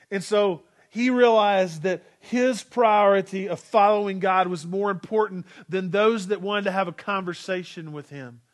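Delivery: usual speaking voice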